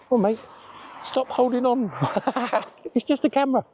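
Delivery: high coaxing talk to a pet; high voice for the dog. Real, not acted. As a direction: coax the nervous dog gently, up high.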